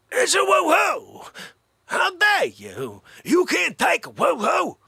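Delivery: high, loud comic yelling, slow in places; hammed-up shouting. Comedian mocking a woo-hoo after being shot, loud comic yelling. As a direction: yell it like a crazed hype man.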